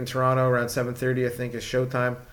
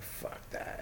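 whispered mock inner thought; barely audible retold aside; 19 dB quieter than usual. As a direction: say it under your breath, almost no voice. Retelling his thought while flicking.

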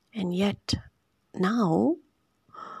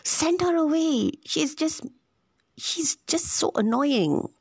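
higher, slow, a touch of mock irritation; irritated disciples; 8 semitones higher, slower than usual. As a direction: play the fed-up disciples, half-hearted. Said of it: "the disciples are so irritated".

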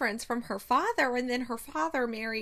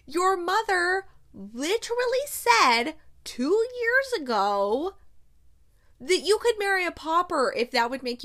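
higher, louder, pleading outrage at a fictional man; exasperated rant; 7 semitones higher, 4 dB louder than usual. Real, not acted. Vlogger scolding Benedict over the book, exasperated. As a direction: plead with him in real frustration. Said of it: "exasperated rant at character".

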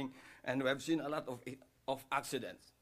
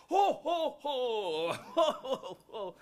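high, loud hearty ho ho ho; Santa laugh; 10 semitones higher, 9 dB louder, slower than usual. Retold or imitated. Bearded man in the snow laughing like Santa, high and loud. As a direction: boom it out like Santa Claus. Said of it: "He said".

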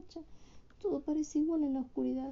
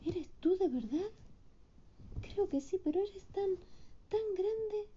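put-on amazed child Hilda; 8 semitones higher than usual.

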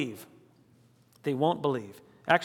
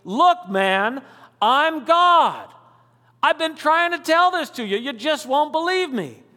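higher, louder, talking like an exasperated buddy; casual Jesus voice; 8 semitones higher, 6 dB louder than usual. Acted. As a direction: play Jesus as a frank friend saying it plainly. Preacher voices Jesus, paraphrased.

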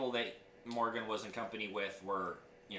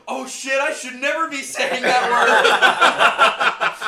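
loud, high mock horror breaking into laughter; horrified realization; 11 semitones higher, 19 dB louder, much slower than usual. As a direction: act appalled while cracking up. Teller voices kid's horrified realization.

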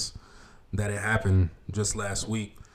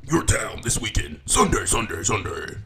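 monster truck ad. Retold or imitated. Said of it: "those monster truck commercials".

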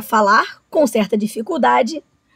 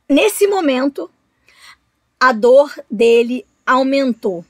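ordinary delivery, nothing marked

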